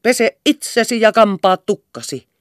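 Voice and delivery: loud, high barked order; shouted command from a stamping mother. A narrator's reading. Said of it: "polkasi jalkaansa ja komensi".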